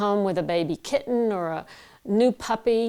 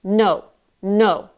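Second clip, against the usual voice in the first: firm, louder no aimed at the palm; projected no; 7 dB louder than usual. Shown as a demonstration. Coach shows the palm exercise, forceful louder no. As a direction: push no into your hand with force. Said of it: "So it would sound like no".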